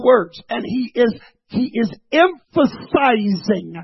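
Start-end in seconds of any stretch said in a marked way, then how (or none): 2.1-3.8 EMPHASIZING stretched out syllable by syllable; slow, punched-out "emphasizing"; drawn-out emphasis. Real, not acted. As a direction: lean slowly and heavily on "emphasizing". Preacher stresses key word.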